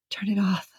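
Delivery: soft, hushed near-whisper; quietly, under the breath. A narrator's reading. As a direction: drop the volume, as if whispering. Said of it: "I whisper".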